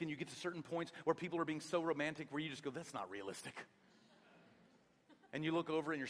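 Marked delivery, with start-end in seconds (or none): 2.7-3.5 low, dry and flat-voiced; deadpan. Husband scoffs at a chick flick, dry deadpan. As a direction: mutter it like an unconvinced husband. Deadpan reaction to romance film.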